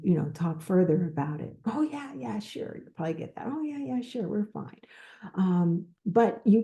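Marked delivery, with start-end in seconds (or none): 1.6-2.8 high, airy brush-off; breezy fake agreement. Mimicking a liar's easy reply. 3.5-4.8 high, airy brush-off; breezy fake agreement. Mimicking a liar's easy reply again.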